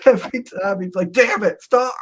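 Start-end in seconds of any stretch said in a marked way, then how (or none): 1.1-1.9 high, loud flustered yell; a drunk's yelp of protest; imitating drunk coworker yelling at the boss to stop; mock yell.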